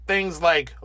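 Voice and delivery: ordinary delivery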